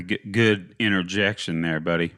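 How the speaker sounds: normal talking voice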